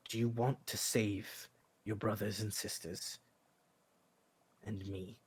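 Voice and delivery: a father quietly pressing his son; father coaxing his boy in a near-hush; hushed fatherly voice. Acted. Father speaks gently to son.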